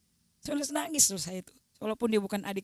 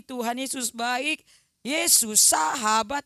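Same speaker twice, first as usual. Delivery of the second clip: slow, doubtful praise; quoting a reluctant prayer, slow and unsure; hesitant praise; 2 semitones higher, 4 dB louder, slower than usual. Acted. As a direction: drag it out half-believing.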